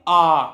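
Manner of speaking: "आ" held long and loud from the throat; teacher showing long "आ" for the class; long vowel demo. Shown as a demonstration. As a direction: stretch out a strong "आ". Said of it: "और बड़ा कैसे बोलेंगे?".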